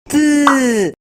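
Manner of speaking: "T" as a short voiceless tongue tap with no vowel; only the tap of "T" behind the teeth; bare T sound. Shown as a demonstration. Teacher leading the class in the bare T sound. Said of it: "Vamos, niños".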